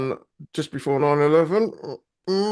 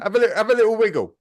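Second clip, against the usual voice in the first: laughing; 8 semitones higher, faster than usual. Real, not acted. Laughing at a broken mic.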